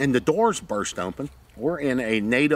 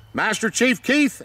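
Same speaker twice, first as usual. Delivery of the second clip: high, loud commanding call of the name; stern call; 12 semitones higher, 6 dB louder than usual. Retold or imitated. Lieutenant trying to stop Keith, loud commanding call. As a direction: bark the title to pull rank.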